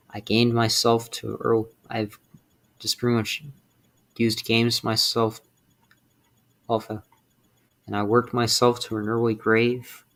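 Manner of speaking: ordinary talk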